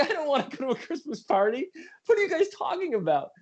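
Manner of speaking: high, incredulous mock complaint; incredulous whine. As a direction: act out your own disbelief at the idea. Mocking his own reluctance.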